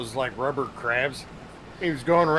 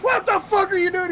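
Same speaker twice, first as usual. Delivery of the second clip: much higher, louder outrage; mock angry yell; 4 dB louder than usual. Captain yelling at his son, mock angry. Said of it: "He goes".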